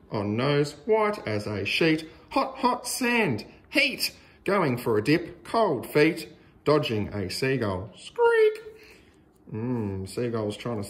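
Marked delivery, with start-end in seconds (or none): 2.3-4.1 much higher, pained little cry; squealing about the heat; hot-sand squeal. A narrator's reading. Feet burning on sand. 8.0-8.6 tiny shrill bird squeak, much higher; little bird cry; seagull squeak. A narrator's reading. Seagull noise.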